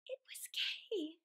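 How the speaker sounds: gasp, then a slow whisper; awed whisper. A narrator's reading. Narrator as Gerda finds Kay, gasping whisper. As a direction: breathe it out in wonder.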